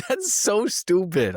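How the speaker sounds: high, slow, mocking scorn; scornful disbelief. Scoffing at a dumb movie line, high and slow. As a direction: laugh it off as dumb. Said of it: "I was like".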